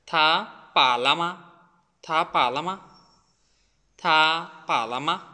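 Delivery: "লামা" with the last syllable lightly let go; teacher's demo, "মা" just said and dropped; pronunciation demo. Shown as a demonstration. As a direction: model "থা, পা, লামা", releasing the final "মা". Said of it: "just উচ্চারণ করে ছেড়ে দিচ্ছি".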